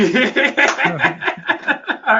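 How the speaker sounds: long loud high laugh. Real, not acted. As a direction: burst out laughing. Laughing at Terry W.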